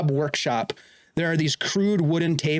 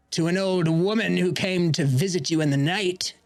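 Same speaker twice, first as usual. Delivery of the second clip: much higher, sweetly menacing hostess voice; mocking witch; 6 semitones higher than usual. Sorceress mockingly welcoming intruders into her workshop. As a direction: welcome them like a spider to its web.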